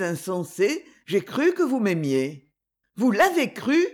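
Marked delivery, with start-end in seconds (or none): 3.0-3.9 high, astonished question; startled, eager query; exclaimed question. A narrator's reading. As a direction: exclaim it, pitch lifting. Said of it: "s'écria Clarisse".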